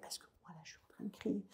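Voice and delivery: put-on soft, fast whisper to calm a child; breathy hush, half-voiced. Shown as a demonstration. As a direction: drop to a whisper so the child copies you. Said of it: "de pouvoir descendre ma voix".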